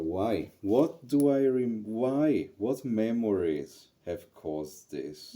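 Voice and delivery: acting out her own alarmed inner voice, slow and loud; urgent self-questioning. Questioning herself about the emotion.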